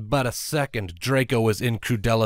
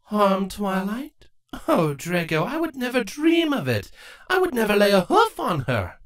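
higher, sugary mock-innocent queen; mock innocence; 11 semitones higher, 3 dB louder than usual. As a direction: play innocent, sweetly rising. Crudalis innocent voice.

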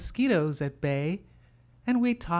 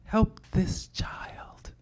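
slow, soft whisper to the Lord; an aunt praying under her breath; whispered prayer; 4 dB quieter, much slower than usual. Retold or imitated. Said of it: "And she said".

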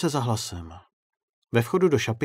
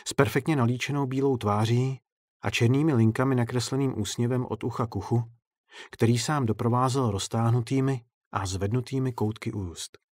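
ordinary delivery

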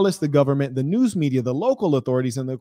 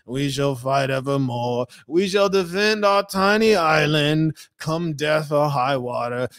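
Winston Churchill impression; slower than usual. Streamer mimics the wartime leader rallying Britain, slow oratorical impression. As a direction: put on a grand British speech, drawn out. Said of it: "Winston Churchill was one of the best orators".

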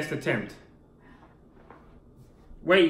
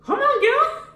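high mocking voice; slower than usual. Teasing partner during challenge.

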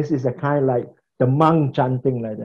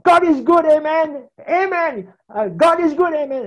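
high, loud preacher cry of praise; roaring preacher; 12 semitones higher, 4 dB louder than usual. Retold or imitated. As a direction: belt it out like a preacher who has lost his thread. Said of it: "And they shout".

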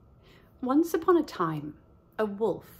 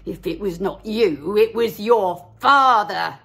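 snarling wolf; 8 dB louder than usual. Acted. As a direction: growl it out like a wolf. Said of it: "snarled the wolf".

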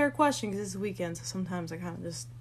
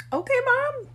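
very high, loud, knowing tease; teasing reaction; 6 dB louder than usual. Real, not acted. Daughter reacts to mom's overnight guest, high-pitched teasing. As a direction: squeal it at mom's expense.

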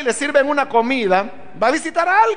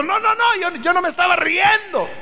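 high, flustered, stumbling denial, a touch of panic; imitating Sarah caught out and scared, higher voice; frightened denial; 8 semitones higher than usual. Sarah denying to the Lord that she laughed, frightened. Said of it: "Entonces le dio miedo".

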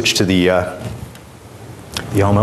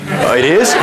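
surprised laugh; 8 semitones higher, 4 dB louder than usual. Real, not acted. Audience corrects the music.

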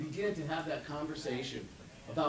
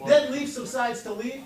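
excited recital; 8 semitones higher, 8 dB louder than usual. Acted. Reciting poem line.

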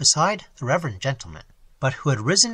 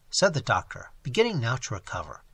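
ordinary delivery, nothing marked

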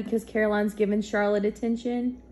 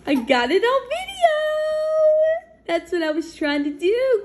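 much higher and louder, thrilled; real excitement; 5 dB louder than usual. Real, not acted. Parent thrilled to catch mama on video, high and loud. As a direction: gush with real joy over the video. Caught baby's word on video.

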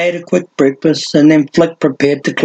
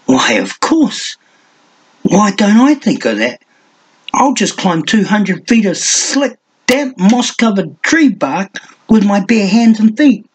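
high sarcastic disbelief; mock wonder; 6 semitones higher than usual. A narrator's reading. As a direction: pile on the sarcasm. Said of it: "He shook his head in mock wonderment".

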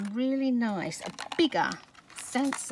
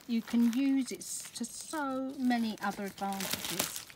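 ordinary talk

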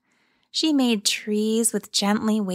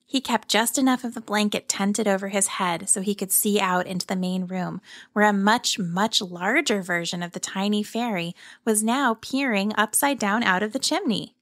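usual speaking voice

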